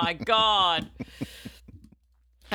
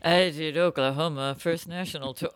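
pilot cockpit headset announcement; slurred captain on the intercom; boozy pilot voice; 4 semitones lower than usual. Retold or imitated. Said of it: "that boozy pilot voice again".

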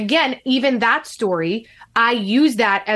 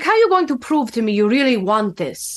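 lightly imitated Russian accent. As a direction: quote him in a mock Russian accent. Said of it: "had a very heavy Russian accent".

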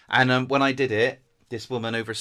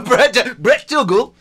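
high, loud comic character voice; 11 semitones higher, 12 dB louder, much slower than usual. Pretend. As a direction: squawk the name in a silly put-on voice. Plays comic [name].

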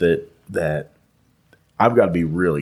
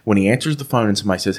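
ordinary talk, nothing marked

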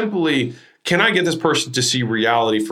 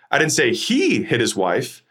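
"he" held long and pitched up; stress on HE. Shown as a demonstration. Coach repeating an emphasis example, stress on HE. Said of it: "then the emphasis".

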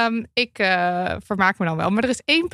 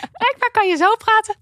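very high, louder squeaky voice; 12 semitones higher, 5 dB louder than usual. Shown as a demonstration. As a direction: demonstrate talking right at the top. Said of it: "hoge stem". (heel hoge piepstem)

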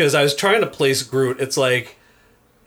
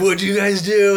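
higher and brighter, eager at the surprise; excited at first, then sour; 6 semitones higher than usual. Retold or imitated. Peter asking friends about gift, excited. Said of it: "he's all excited at first".

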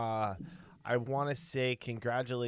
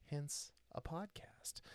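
slow, dry whisper under the breath; whispered aside; 5 semitones higher, 11 dB quieter, slower than usual. Real, not acted. Host joking to listeners about the length, in a dry whisper. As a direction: whisper the joke slowly to yourself.

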